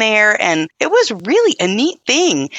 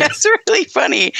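laughing; 6 semitones higher than usual. Real, not acted. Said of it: "listener laughs again".

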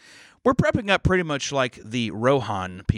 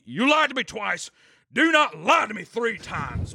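higher, slow and measured mock warning; retold stern warning; 9 semitones higher, slower than usual. Neighbor confronting intruder.

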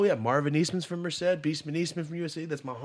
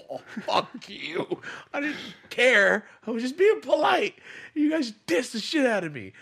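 mock indignation; 11 semitones higher, 6 dB louder than usual. Snubbed by the crew, mock indignant. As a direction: protest loudly, comically offended. Acts out indignation at being ignored.

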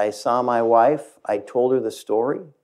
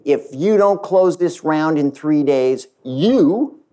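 raised, higher pitched angry accusation; a co-founder confronting the new CEO, angry and raised; angry outburst; 5 semitones higher than usual. Retold or imitated. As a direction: jab the words at him, heated. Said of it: "got up quite angry".

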